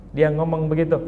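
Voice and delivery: ordinary talk, nothing marked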